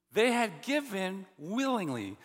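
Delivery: "They had given willingly" slow, each word weighted; slow stressed phrase. Shown as a demonstration. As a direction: spell it out slowly, landing on "willingly". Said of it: "Do you hear the emphasis?".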